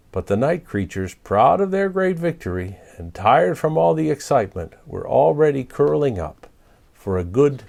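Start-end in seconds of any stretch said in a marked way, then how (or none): none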